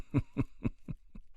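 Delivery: light rapid chuckle. Acted. Conversationalist amused by the lousy boss prescription, chuckling.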